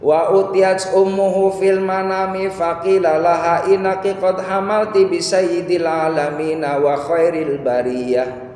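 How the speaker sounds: high, slow, sustained chant; Arabic recitation. Real, not acted. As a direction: intone the sacred text with drawn-out syllables. Recites an Arabic text melodically.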